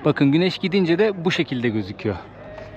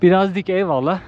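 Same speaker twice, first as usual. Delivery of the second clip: calling out to hear the echo; raised voice ringing off rock walls; cave echo; 6 semitones higher, 4 dB louder than usual. Real, not acted. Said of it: "Sesim yankı yapıyor ha". (yankılı, yüksek sesle seslenme)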